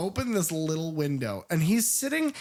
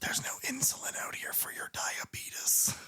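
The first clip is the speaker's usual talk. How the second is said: hushed, mocking whispered threat; sinister whisper; 9 semitones higher, 8 dB quieter than usual. The Thing taunts Blair about insulin in a gloating whisper.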